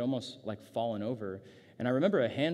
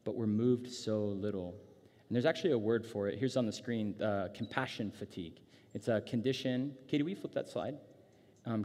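ordinary talk, nothing marked

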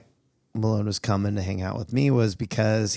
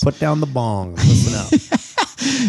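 very slow, louder and higher mock announcer call; drawn-out announcer call; 4 semitones higher, 6 dB louder, much slower than usual. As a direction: stretch it out like calling a crowd. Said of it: "mock call to listeners".

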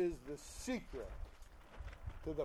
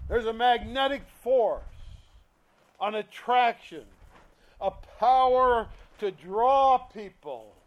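loud, driving sermon voice; preacher pounding the message; preaching; 2 semitones higher, 6 dB louder than usual. Acted. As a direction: press the point home. Preaching at full force.

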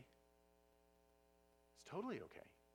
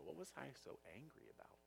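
quiet bemused musing; 13 dB quieter, faster than usual. Retold or imitated. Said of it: "I watch myself and I go".

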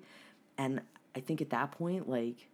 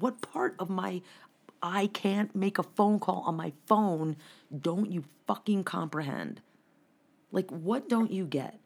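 retold angry rant; 6 semitones higher than usual. Ranting at store manager, retold.

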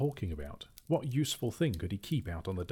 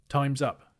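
higher, louder announcement; loud call; 7 semitones higher, 7 dB louder than usual. A narrator's reading. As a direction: call the time firmly. Bilbo calling time.

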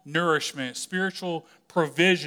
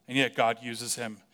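ordinary talk

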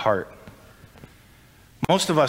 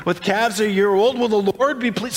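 Israelites asking; 9 semitones higher than usual. Acted. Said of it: "They say".

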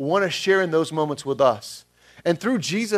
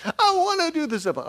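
mock tearful kid wanting the zip line; imitating crying child; 11 semitones higher than usual. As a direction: play the tearful son begging to go. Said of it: "He's, like, crying".

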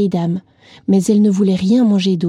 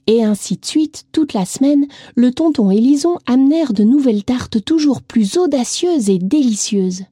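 usual speaking voice

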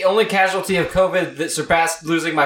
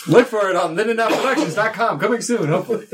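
upbeat promo read, pitched up; website plug; 6 semitones higher than usual. Shown as a demonstration. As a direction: announce it like a radio ad. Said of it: "We're plugging that website like crazy".

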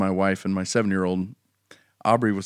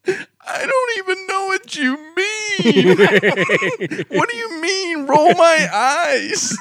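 high, slow mock sob, laughing through it; imitating a crying child; 3 dB louder, slower than usual. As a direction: wail it like a confused crying child. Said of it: "she's sitting there crying".

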